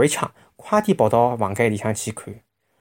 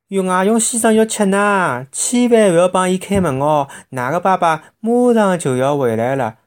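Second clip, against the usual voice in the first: calling out; 6 semitones higher, 3 dB louder than usual. A narrator's reading. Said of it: "拼命个叫".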